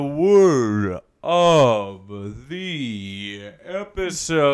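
slow, drawn-out announcer. Acted. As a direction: announce it grandly, slowly. Announcing segment title.

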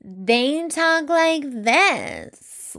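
very slow, high, stretched West Coast drawl; exaggerated West Coast slow talk, every word dragged out; West Coast accent. Podcaster mocking how West Coasters talk, slow West Coast drawl. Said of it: "If he moved to the West Coast".

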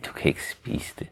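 hushed whisper of a line. Acted. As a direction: whisper it close. Hvisket replik. (hvisket replik)

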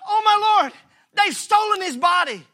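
high, loud frantic cry of alarm; panicked Mary. Acted. Mary at the empty tomb, panicked and loud.

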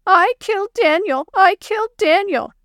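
high, loud wail of mock crying; hammed-up distraught little girl; crying child. A narrator's reading. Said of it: "put her head down and started crying".

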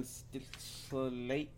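"slate" split as "suh-late", rising; oddly drawn-out slate. Real, not acted. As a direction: stretch "slate" into a halting "suh-late" that rises at the end. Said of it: "why I said it like that".

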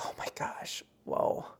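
very soft, voiceless awe; stunned whisper. Retold or imitated. Reader hearing the necklace was her father's, stunned whisper. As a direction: whisper it, floored by what you heard.

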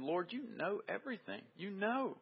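hushed, desperate plea from Peter. Retold or imitated. Peter answering Jesus, a hushed desperate plea. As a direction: plead quietly, almost breaking. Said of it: "you can almost hear desperation in his voice".

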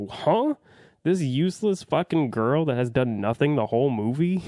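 a sharp high "Huh?" then scoffing incredulity; mock disbelief. Reviewer scoffing at the twist, in mock disbelief. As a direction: squeak out the huh, then sneer at the useless girl.

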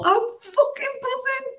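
very high, thrilled squeak; high giddy exclaim of joy. Retold or imitated. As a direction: squeak it out in pure delight. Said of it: "I look at him and I go".

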